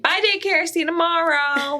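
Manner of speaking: put-on high, cheery little kid; sing-song toddler goodbye. Toddler quote.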